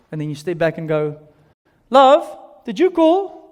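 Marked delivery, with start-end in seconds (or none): 1.9-3.4 high, raised call ending on a rise. Acted. Husband asks if wife called from elsewhere, calling out. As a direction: call through the house to your partner. Calls to partner across the house.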